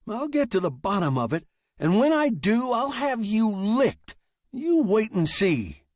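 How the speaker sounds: high, forceful, heated vow; threatening writer. Acted. Writer vowing to beat his machine, high heated threat.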